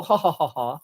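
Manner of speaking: a hammed-up snooty socialite chuckle; mock snobby laugh. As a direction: fake posh "ha ha ha" laugh. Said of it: "making fun of snobby people".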